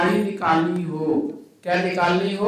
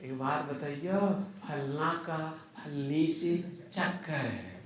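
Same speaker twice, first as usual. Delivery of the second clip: village gossip; 3 semitones lower, 12 dB quieter than usual. Retold or imitated. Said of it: "नहीं सुनते हैं गांव देहात में".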